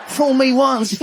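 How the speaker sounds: chuckly politician impression; George Bush. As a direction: talk as if about to chuckle. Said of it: "that weird chuckly thing".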